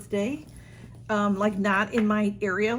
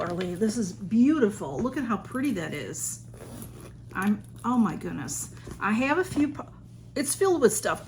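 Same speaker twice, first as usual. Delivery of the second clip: ordinary delivery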